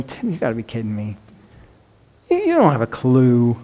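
lightly voiced exasperated God; mock God's voice. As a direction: play God gently shaking his head at Tim. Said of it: "God's gotta be shaking his head going".

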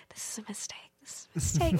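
hushed, whispered inner doubt; acted-out whisper to oneself; retold anxious whisper. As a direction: whisper it like a secret panic. Retold nervous thought to self.

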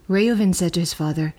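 ordinary delivery

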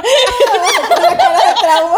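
high, loud, drawn-out words through laughter; laughing. Real, not acted. Co-host echoing the trauma-face joke, laughing hard. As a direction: repeat the joke while cracking up.

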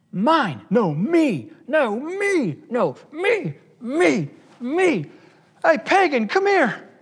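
put-on high, loud squabble of grabby kids; whiny high-pitched bickering; childish squabbling. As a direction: shout mine like a selfish child. Acting out squabbling selfish believers.

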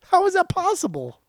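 high, slow, incredulous voice; baffled disbelief. Retold or imitated. Host recalling confusion over how [name] talks versus sings. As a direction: sound utterly baffled. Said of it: "I was like".

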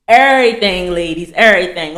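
EVERYTHING hit hard and loud, repeated; preacher pressing the point that it means everything; emphatic everything. Real, not acted. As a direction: drive the word everything home to the ladies.

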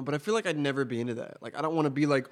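usual speaking voice

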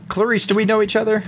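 high, earnest questioning; John, tentative question. Acted. Game master plays John asking.

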